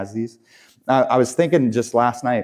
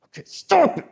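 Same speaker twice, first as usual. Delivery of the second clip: a much higher, squeaky mock order to yourself; mock self-command. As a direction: play the naive believer snapping "stop it" at himself. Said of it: "what I need to do".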